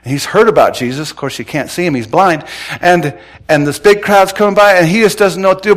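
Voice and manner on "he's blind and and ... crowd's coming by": usual speaking voice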